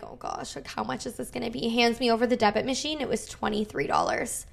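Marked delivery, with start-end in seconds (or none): 0.0-1.7 whispered, dreading the price; anxious whisper. Retold or imitated. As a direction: breathe it out, bracing for bad news. Nervous before seeing the bill.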